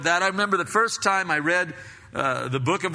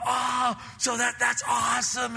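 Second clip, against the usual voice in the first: excited; 7 semitones higher than usual. Retold or imitated. Reader excited by a revelation.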